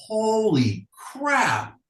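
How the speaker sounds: high, slow, drawn-out wonder with a laugh; amazed exclaim. Retold or imitated. Hearing the album for the first time, high laughing awe. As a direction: gasp it out like it just blew your mind. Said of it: "and I was like".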